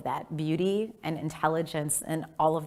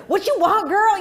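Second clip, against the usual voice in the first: mom impression; 13 semitones higher, 10 dB louder than usual. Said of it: "And my mom's like".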